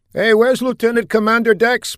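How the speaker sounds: high, loud call across the hangar; yelled. Acted. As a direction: shout it toward the group. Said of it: "Patel yelled".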